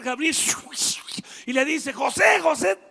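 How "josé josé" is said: calling out. Acted. Angel calling José.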